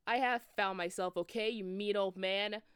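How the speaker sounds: put-on loud angry yell at the old man. Boy yelling at Dumbledore, loud and furious. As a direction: shout him down, furious. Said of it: "Hargard yelled".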